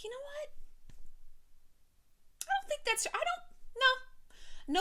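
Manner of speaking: high, hushed and halting; hesitant whisper. Retold or imitated.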